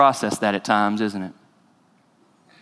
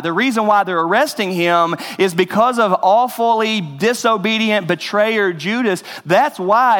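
raised, higher preacher's voice pressing each point; preaching at full force; 6 semitones higher than usual. Acted. Pastor urging the congregation about Judas and Peter, fervent preaching. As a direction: drive the message home from the pulpit.

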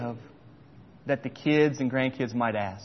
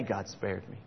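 much softer, lower, moved; emotional; 7 semitones lower, 14 dB quieter than usual. Real, not acted. Preacher moved before his church, hushed and emotional. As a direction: say it hushed, overcome. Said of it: "I'm kinda getting emotional".

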